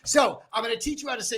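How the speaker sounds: ordinary talk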